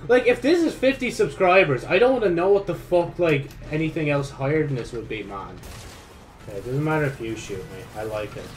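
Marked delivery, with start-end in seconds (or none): none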